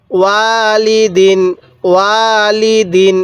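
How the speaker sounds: ওয়ালিদিন read slowly with one alif on ওয়া; slow recitation. Shown as a demonstration. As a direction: draw out ওয়ালিদিন syllable by syllable. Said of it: "এক আলিফ টান দিব".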